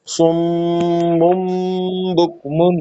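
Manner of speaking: سم مم بک من with nasal holds on م; model ghunna reading. Shown as a demonstration. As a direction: hum each م gently. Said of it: "تو اس طرح پڑھیں گے". (سم مم بک من غنہ کے ساتھ)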